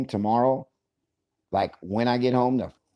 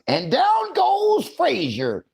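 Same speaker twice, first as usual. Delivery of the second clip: drawn-out, high ringside announcer yell; stretched high fight-call; 4 dB louder, much slower than usual. Pretend.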